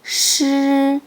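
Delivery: 시 as a retroflex "sh", tongue curled up. Shown as a demonstration. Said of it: "시읒을 혀를 올리고 발음해 보세요".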